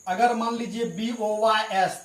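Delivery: usual speaking voice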